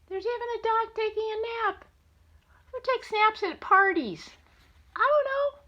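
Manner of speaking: high, sing-song wonder; playful high. Acted. Grandma teasing her grandson about a napping dog, high and playful. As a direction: play it up in a high voice.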